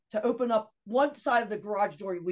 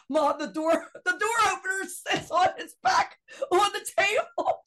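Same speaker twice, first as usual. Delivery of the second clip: high, amazed call from her son; 10 semitones higher, 3 dB louder than usual. Retold or imitated. Son calling to mom about the opener, high amazed shout. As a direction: imitate the son calling out in disbelief.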